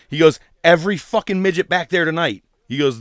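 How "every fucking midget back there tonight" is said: loud, raised rallying voice; defiant ring speech. Retold or imitated. Wrestling promoter backing his wrestlers, defiant. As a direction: fire up the crowd.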